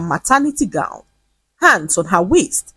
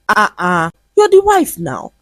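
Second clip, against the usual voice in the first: grinning tease. Acted.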